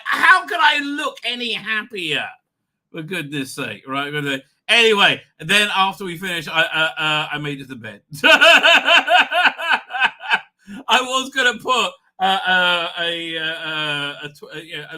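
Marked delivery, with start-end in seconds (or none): 0.1-2.3 high, loud, drawn-out retort; mock exasperated shout. Dad answering whether he is happy, mock exasperated shout. 8.1-10.4 loud, high peal of laughter; real belly laugh. Real, not acted. Man laughing at making it to bed, real loud laugh. Laughing at his own story.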